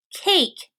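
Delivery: Cake lifted high, long A stretched; stressed long vowel. Shown as a demonstration. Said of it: "is a long sound".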